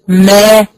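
ما with lips parting into an open, rising a; touch the lips, then open the jaw wide on ما; ما pronounced with the lips touching, then the jaw dropping open; open meem demo. Shown as a demonstration. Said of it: "هقول إيه؟".

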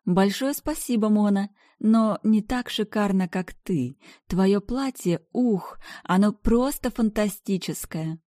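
high, sugary gushing compliment; sugary socialite. Acted. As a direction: coo the compliment sweetly.

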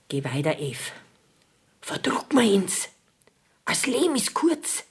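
hushed, secret whisper of a lover urging her. Retold or imitated. Martha imitates Ruprecht coaxing Eve at night, whispering.

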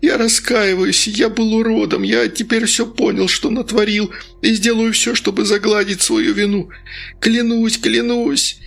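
high, desperate begging; terrified babbling vows; frantic pleading. Acted. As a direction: plead for your life. Denis begs, continued.

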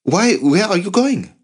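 high-pitched anxious question; alarmed questioning. A narrator's reading. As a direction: call after the uncle in alarm. Alarmed nephew as uncle leaves.

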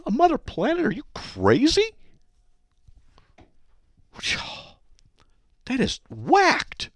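incredulous. Real, not acted. Host scoffs at quoted claim.

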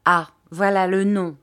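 loud cry of recognition. A narrator's reading. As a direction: call it out as the name comes back. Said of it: "cria monsieur Peggotty". (s'écrier d'une voix forte)

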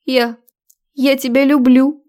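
high, hesitant declaration of love; sweetly pleading female character; cloying confession. A narrator's reading. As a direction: confess it in a sugary, needy voice. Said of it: "от её приторных слов".

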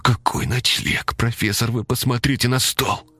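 panicked whisper; desperate whisper. Acted. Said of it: "отчаянно зашептал".

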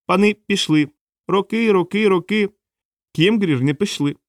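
high little creature voice; thin squeaky voice. A narrator's reading. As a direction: pipe it up high as a small creature. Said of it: "тоненький пискливий голосок".

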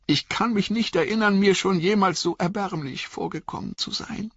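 hohe, jammernde Hobbitstimme voller Angst; verängstigter Pippin. Acted. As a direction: klagt kläglich, dem Weinen nahe. (verängstigter Hobbit, hoch und jammernd)

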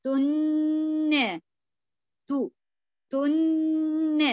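teacher isolates "تن" with full ghunna. Shown as a demonstration. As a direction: hum the noon of "تن" fully. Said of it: "النون بغنتها كاملة".

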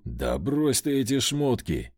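ordinary delivery, nothing marked

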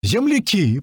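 high-pitched happy shout; joyful yelling. A narrator's reading. Newcomer keeps yelling at the men, overjoyed. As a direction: yell a greeting to fellow countrymen. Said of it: "продолжал тот орать".

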